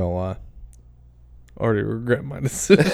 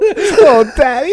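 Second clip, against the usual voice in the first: mock moan; 11 semitones higher, 7 dB louder, slower than usual. Joking sexual mock moan.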